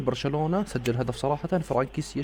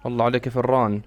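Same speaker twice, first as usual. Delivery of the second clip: ordinary talk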